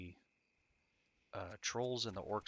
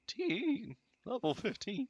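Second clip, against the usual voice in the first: voice cracking; 5 dB louder, much slower than usual. Real, not acted. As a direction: voice cracks up much higher. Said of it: "My voice cracking".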